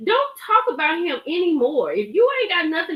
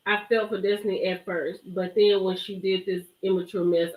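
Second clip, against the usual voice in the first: usual speaking voice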